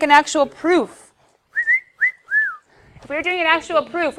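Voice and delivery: ordinary delivery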